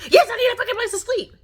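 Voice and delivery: high, loud, fast mock of a snapping mother; hammed-up mimic of a bratty snap; mocking snappy Crystal.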